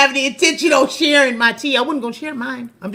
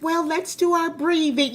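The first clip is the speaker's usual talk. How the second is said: gentle teacher; 6 semitones higher than usual. Retold or imitated. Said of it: "She's just so gentle and".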